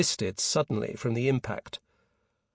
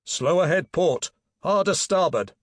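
raised, higher helm order; firm command; 5 semitones higher, 5 dB louder than usual. A narrator's reading. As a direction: call orders down the voice pipe. Orders down the voice pipe.

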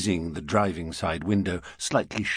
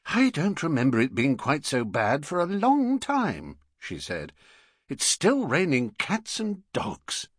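Mrs Brown; 7 semitones higher than usual. Acted.

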